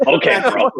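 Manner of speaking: mock bro voice. Mocking Jeff's bro voice.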